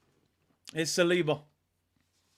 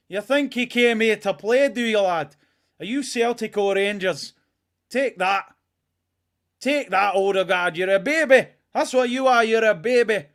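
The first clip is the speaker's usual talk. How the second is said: loud, higher Scottish taunting; Scottish accent; 4 semitones higher, 7 dB louder than usual. Acted. Fan taunting Odegaard as a Scottish opponent, loud Scottish accent. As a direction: jeer like a rowdy Scot. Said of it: "Not a bad accent".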